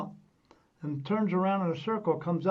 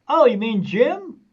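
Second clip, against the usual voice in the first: higher, louder and slow, a sudden dawning; surprised realization; 6 semitones higher, 11 dB louder, slower than usual. Retold or imitated. Woman at the bar suddenly recognizing the name, surprised. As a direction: let it dawn on her, drawn out and loud.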